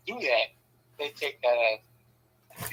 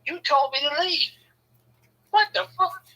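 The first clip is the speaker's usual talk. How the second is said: high, incredulous outburst; exasperated disbelief. Retold or imitated. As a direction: throw it back, pitched way up in disbelief. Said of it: "I'm like".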